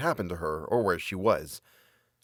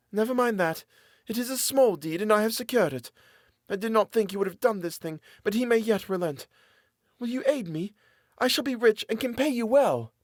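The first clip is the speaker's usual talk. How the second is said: much higher, desperate woman begging; pleading captive woman; 11 semitones higher than usual. A narrator's reading. Captive woman pleading with her jailer for mercy. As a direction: beg for mercy in a high woman's voice.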